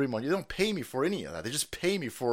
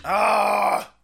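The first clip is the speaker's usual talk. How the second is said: refreshed gulp ah; 5 semitones higher, 8 dB louder than usual. Pretend. After gulping drink.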